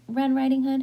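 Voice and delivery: ordinary delivery, nothing marked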